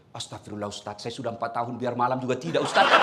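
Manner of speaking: low lament. Retold or imitated. Congregant laments quietly.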